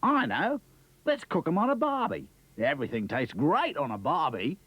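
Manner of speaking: high, bouncy Aussie voice; a cheery kangaroo exclaiming; Australian kangaroo. Acted. Kangaroo Rupert's voice.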